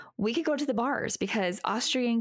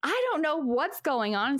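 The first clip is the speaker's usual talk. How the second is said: usual speaking voice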